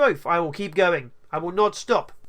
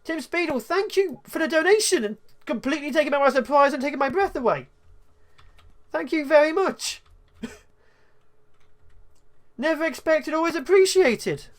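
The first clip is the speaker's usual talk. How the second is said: higher, breathless thanks for a sudden donation; caught by surprise; 6 semitones higher than usual. Real, not acted. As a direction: gush with startled gratitude. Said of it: "That took me completely by surprise".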